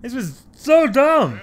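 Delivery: loud, slow, high exasperation; getting mad. Real, not acted. Player mad at the game, slow angry outburst. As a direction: drag it out in real anger. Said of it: "I'm getting mad right now".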